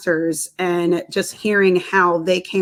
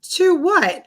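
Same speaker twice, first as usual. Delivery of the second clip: high-pitched mock bewilderment; retold bewilderment; 10 semitones higher than usual. Patient questioning the technician, acted high bewilderment. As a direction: question it high, acting out confusion. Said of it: "I was like".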